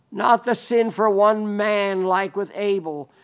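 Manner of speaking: usual speaking voice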